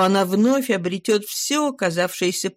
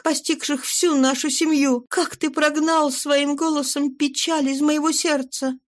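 higher woman's voice, worried and searching; pleading wife; 7 semitones higher than usual. A narrator's reading. Wife questions her changed husband, higher worried voice. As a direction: plead gently as an anxious wife.

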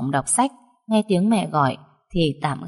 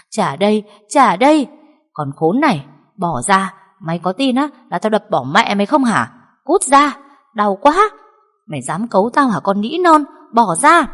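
furious shouting, much louder and higher; angry struggle shout; 3 semitones higher, 5 dB louder than usual. Acted. Girl and stepmother wrestle over the photo, yelling furiously. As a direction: scream in a tug-of-war fight.

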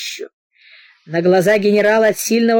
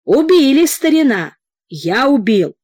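triumphant; 6 semitones higher, 4 dB louder than usual. A narrator's reading. General triumphant over bear.

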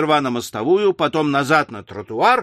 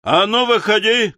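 high, raised challenge to fight; higher-pitched combative call; shouted challenge; 6 semitones higher, 3 dB louder than usual. A narrator's reading. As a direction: call them out like a brawling cabman. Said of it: "кричал кэбмен".

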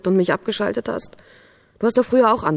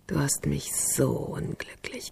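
softer, very slow whisper; despairing whisper; 3 semitones lower, 9 dB quieter, slower than usual. Acted. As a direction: let the last word die away. Despairing last words.

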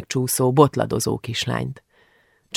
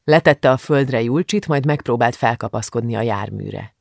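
plain reading voice

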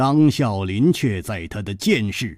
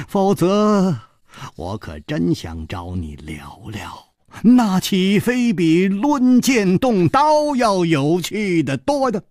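mild playful wistfulness; smiling, sighing regret; 8 semitones higher, 4 dB louder than usual. A narrator's reading. Hero wishes the killer would chat, mild smiling regret. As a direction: lament it lightly with a grin. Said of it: "张啸林微笑着叹息着说".

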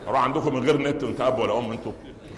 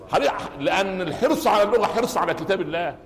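normal talking voice

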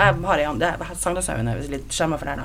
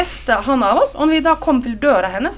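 ordinary talk, nothing marked

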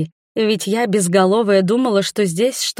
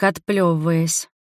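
ordinary delivery

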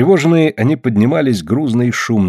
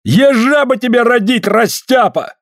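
high, loud angry yell; 13 semitones higher, 3 dB louder than usual. Acted. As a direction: yell at him in fury. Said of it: "Афанасий зло орал".

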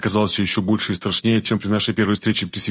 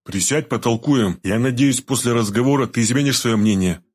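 plain reading voice

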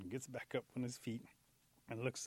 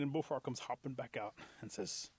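usual speaking voice

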